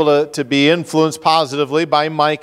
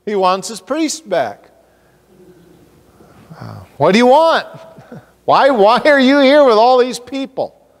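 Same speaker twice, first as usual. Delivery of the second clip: incredulous retort; 9 semitones higher than usual. Pretend. Preacher voicing his own indignant reply to Dan. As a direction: scoff at the question with raised voice. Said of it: "I'm thinking to myself".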